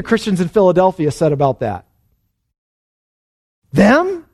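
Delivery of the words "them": high, incredulous question; one word shooting up in disbelief; mock disbelief. Preacher voicing the Philadelphia Christians, mock incredulous.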